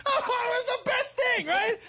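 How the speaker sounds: high, laughing shout. Real, not acted. Retelling the dentist story to friends, laughing. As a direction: burst out laughing through it. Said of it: "laughing at the memory".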